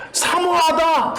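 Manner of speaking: 사모하다 stretched slowly and pitched high; drawn-out key word. Shown as a demonstration. Teacher stressing the positive meaning, slow and high. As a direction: lean on 사모하다, drawing out every syllable. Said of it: "하고 싶어하다 이러면 어째요?".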